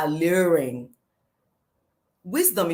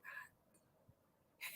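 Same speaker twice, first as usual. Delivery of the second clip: whisper; 35 dB quieter, much slower than usual. Retold or imitated. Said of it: "I was like".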